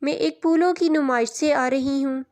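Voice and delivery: ordinary talk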